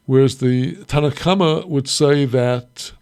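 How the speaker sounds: ordinary delivery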